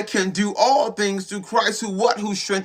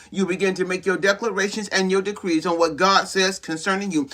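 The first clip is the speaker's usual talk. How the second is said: ordinary talk, nothing marked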